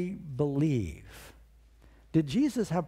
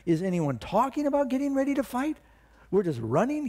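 much higher, pressing rhetorical questions; young David's indignant plea; outraged David; 7 semitones higher than usual. Acted. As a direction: stir the cowards to fight. David rebukes the fleeing soldiers.

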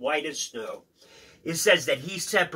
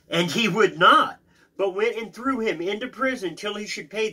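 raised, emphatic Bible reading; scripture reading; 7 semitones higher, 5 dB louder than usual. Acted. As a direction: read the parable out with force. Reads scripture aloud.

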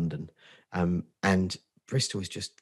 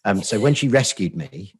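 ordinary delivery

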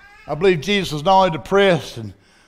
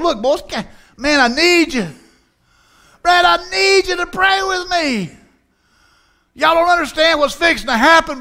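urgent, much higher, rising to a shout; pleading Jesus; 9 semitones higher than usual. Retold or imitated. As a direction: beg the boys to pray with him, voice climbing. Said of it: "And Jesus is going".

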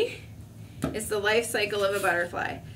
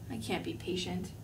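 dropped low and soft, giving up; despairing mutter; 4 semitones lower, 11 dB quieter than usual. Acted. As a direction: sink into it, barely voiced. Caterpillar despairs.

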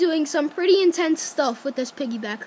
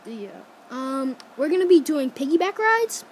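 normal talking voice

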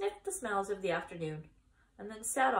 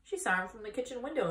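plain reading voice

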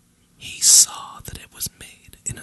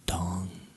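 a single ringing "dong"; imitated clock chime; 4 semitones lower, 6 dB quieter than usual. A narrator's reading. Clock striking midnight at the ball, imitated chime. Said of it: "rung the clock".

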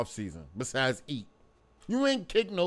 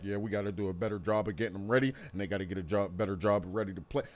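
lower, fast, droning coach-speak parody; mocking Tomlin; 9 semitones lower, 3 dB quieter, much faster than usual. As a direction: mumble the stock press-conference line like a bored coach. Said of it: "Tomlin like".

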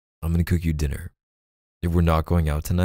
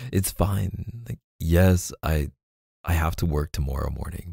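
ordinary delivery

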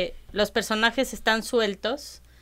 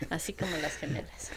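soft unvoiced whisper; 3 semitones lower, 10 dB quieter than usual. Real, not acted. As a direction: breathe it as a laughing aside. Teasing aside during the chat.

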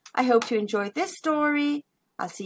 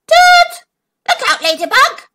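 loud, high trumpet blast then warning; trumpeting elephant; 9 dB louder than usual. Acted. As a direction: blare it like an elephant. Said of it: "he trumpeted".

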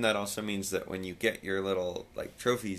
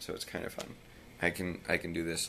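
ordinary talk

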